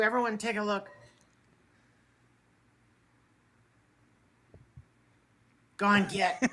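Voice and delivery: bent over tying a shoe; bending to tie shoe. Real, not acted. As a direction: talk while leaning down to the laces. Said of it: "Hold on, I gotta tie my shoe".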